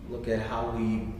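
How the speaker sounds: normal talking voice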